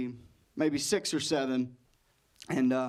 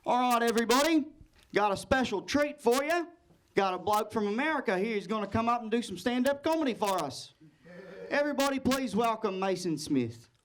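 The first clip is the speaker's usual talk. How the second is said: high-pitched, upbeat Australian announcer; Australian host; 7 semitones higher than usual. Acted. Host introduces American comic to the room, in an Australian accent. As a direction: introduce the act in a lilting Aussie accent. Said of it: "and the host says".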